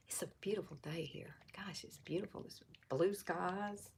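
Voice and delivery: very soft, near-whispered musing; hushed aside. Real, not acted. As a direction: drop almost to a whisper, admiring. Soft aside about the weather.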